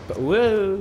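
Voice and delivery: startled whoa; panic zombie noise. Shown as a demonstration. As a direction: yelp it like a panicked zombie groan. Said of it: "That's my panic zombie noise".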